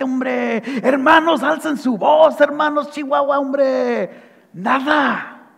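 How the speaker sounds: ordinary talk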